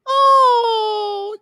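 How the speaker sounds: very high, loud, gushing; high mock mom voice. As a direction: gush like a mom touched by a gift. Voicing the mom receiving a gift.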